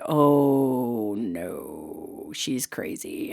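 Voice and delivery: low, hushed, drawn-out dread; wary mock dismay. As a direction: sink into a whispery aside about a crazy person. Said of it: "she's got that energy like".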